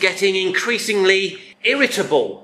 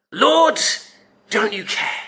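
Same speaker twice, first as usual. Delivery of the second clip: angry shout; 6 semitones higher than usual. Acted. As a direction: burst in with an acted angry shout.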